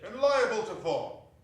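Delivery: loud, high protest; remonstrating. Acted. Scrooge protests to the spirit, loud remonstration. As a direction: object in alarm. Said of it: "Scrooge remonstrated".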